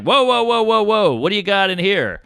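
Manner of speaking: a mild mock "whoa, whoa" from the security guard; TSA guy. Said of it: "he's like".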